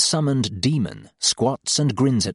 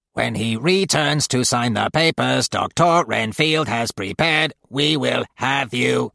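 slow, grating nurse voice. A narrator's reading. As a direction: grate it out slowly. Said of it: "grates the middle sister".

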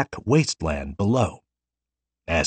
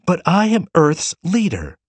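put-on higher, louder girl's protest; shouted; 10 semitones higher, 3 dB louder than usual. A narrator's reading. Tearful girl shouting her rank at the Forerunner. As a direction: cry it out insistently. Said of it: "the girl shouted".

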